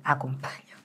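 hushed, low plea; whispered prayer. Retold or imitated. Retelling her prayer for protection, whispered.

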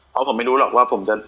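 ordinary talk

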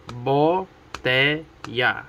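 Botella broken into BO, TE, LLA with pauses; split into syllables. Shown as a demonstration. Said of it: "Three syllables".

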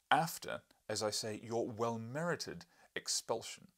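loud, slow stress on well-merited; pointed correction. Acted. Professor to journalist, loud slow stress on well-merited. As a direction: lean hard on well-merited.

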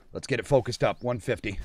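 low, very quiet concentration; hushed focus. Real, not acted. Hunter lining up a shot, hushed. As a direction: drop right down before the shot.

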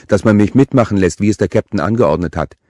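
complaint made while eating; mouth full. A narrator's reading. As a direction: talk round a mouthful. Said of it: "sagte sie mit vollem Mund".

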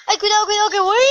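urgent high warning shout; alarmed shout. Real, not acted. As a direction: yell a warning about the wasp. Wasp flying near, warning.

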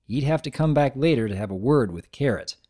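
ordinary delivery, nothing marked

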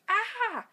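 high, loud "ah" cry; startled scream, cut short; frightened shout. A narrator's reading. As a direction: cry out in alarm. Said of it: "Owen shouted".

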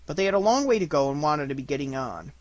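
ordinary delivery, nothing marked

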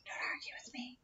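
whispered telling-off; real whisper. Real, not acted. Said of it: "we're whispering in front of the camera".

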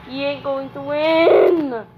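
slow loud gloating taunt. Real, not acted. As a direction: drag it out and shout it. Kid taunting opponent.

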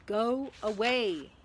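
slow, drawn-out brush-off; fed-up dismissal. A narrator's reading. Ron brushes off nagging Hermione, fed up. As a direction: draw out each word to get rid of her.

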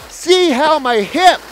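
usual speaking voice

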